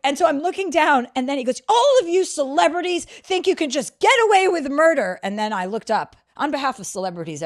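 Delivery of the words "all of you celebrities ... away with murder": high, loud mock accusation; imitated screaming. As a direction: shout it like the outraged stranger. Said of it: "screaming like that".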